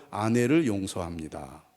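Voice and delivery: slow, low-pitched declaration; measured lead-and-repeat line; lead for repetition. Shown as a demonstration. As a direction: say it slowly for the husbands to repeat. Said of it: "남편들 따라서 하십시오".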